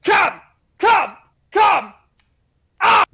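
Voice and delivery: hammed-up climax yells; intense yell. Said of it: "he's like".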